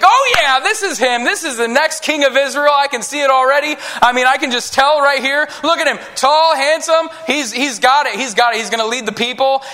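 high-pitched, thrilled outburst; fired-up excitement. Acted. Samuel seeing the next king pass by, fired-up excitement. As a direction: burst out excited, sure you've found the winner. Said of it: "he gets fired up. He gets excited".

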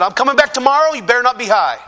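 high, loud threat; worked-up ultimatum, acted out; retold mad warning. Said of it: "made me mad".

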